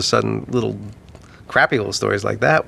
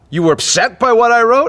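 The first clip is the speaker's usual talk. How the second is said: higher and louder, hackles up; bristling retort; 11 semitones higher, 5 dB louder than usual. Retold or imitated. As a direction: snap back defensively, pitched up. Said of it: "his hackles went up and he went".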